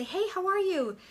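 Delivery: upbeat hello to a new contact; bubbly greeting. Shown as a demonstration. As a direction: gush a little as you say hi. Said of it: "And I'll just say".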